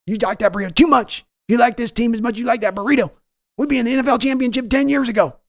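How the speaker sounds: high, loud bellow of an angry team owner; Jerry Jones yelling. Retold or imitated. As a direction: yell for your son like a furious boss. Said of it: "your Jerry Jones voice yelling at Steven".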